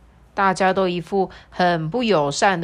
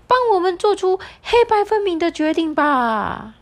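much higher, insistent parrot; 11 semitones higher, 5 dB louder than usual. Acted. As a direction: squawk it up high and pushy. Parrot demands a decision.

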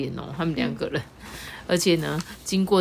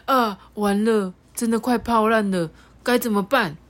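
worried thought; 5 semitones higher, 3 dB louder than usual. Acted. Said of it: "心想".

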